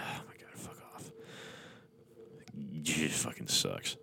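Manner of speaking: whispered, fed-up groan; exasperated whisper. Real, not acted. Reader hating the story ending, whispered groan. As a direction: mutter it under your breath in disgust.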